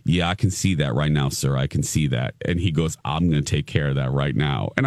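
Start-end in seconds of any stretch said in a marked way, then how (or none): 0.0-2.3 low-pitched imitation in a Massachusetts accent; masseur's low voice, mock Massachusetts accent; attempted Massachusetts accent. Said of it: "Massachusetts for my accent". 3.0-4.7 low-pitched imitation in a Massachusetts accent; masseur's low voice, mock Massachusetts accent; attempted Massachusetts accent. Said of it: "Massachusetts for my accent".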